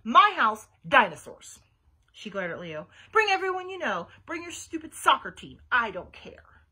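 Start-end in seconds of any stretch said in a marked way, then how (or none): 0.0-1.6 loud, mostly high angry challenge; yelled. A narrator's reading. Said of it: "she yelled". 3.1-6.6 loud, defiant outburst; angry dare. A narrator's reading. Angry dare, glaring at friend.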